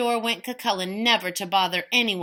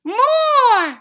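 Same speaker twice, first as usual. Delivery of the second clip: put-on bawling baby; 11 dB louder than usual. Baby demands.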